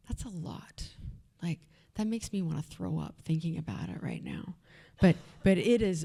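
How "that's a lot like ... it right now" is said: hushed, voiceless aside; whispered in disgust at the memory. Real, not acted. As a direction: drop to a whisper as if confessing. Aside about drinking amount.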